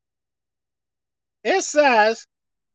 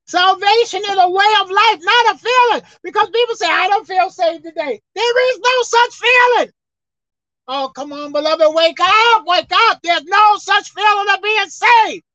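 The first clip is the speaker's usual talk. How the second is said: loud, higher, rising preacher's cry; preaching at full force; 6 semitones higher, 4 dB louder than usual. Acted. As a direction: drive it home to the congregation.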